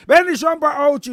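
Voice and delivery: loud call-out. Real, not acted. Streamer sending a blessing to Ochi, loud call-out.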